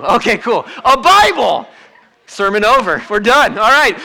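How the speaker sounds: hammed-up thrilled answer; mock excitement. Preacher mocking the easy Sunday school answer, bursting out excited.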